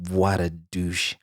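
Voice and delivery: low, soft, slow verdict; disgusted aside. Real, not acted. Judging the ex's message, low slow disgusted aside. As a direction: mutter your contempt.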